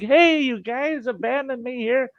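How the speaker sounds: high, whiny "abandoned me"; dad whining to the family walking off; mock complaint. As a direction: plead like a left-behind dad. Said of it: "And I'm like".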